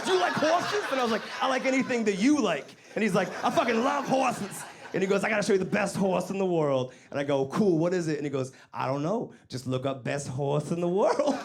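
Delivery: lively high retelling of the dialogue; high-pitched retold chat. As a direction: keep the voices up and bright.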